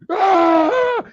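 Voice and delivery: high, loud "aaah" scream; long desperate charging yell; imitated battle yell. Recruit launching himself across the monkey bars, imitated yell.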